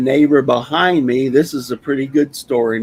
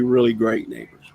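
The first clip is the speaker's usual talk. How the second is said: usual speaking voice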